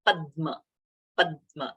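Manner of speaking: Padma with the d held briefly before ma; "Padma" said as "pad-dma"; pressed doubled consonant. Shown as a demonstration. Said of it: "we are pressing that pa".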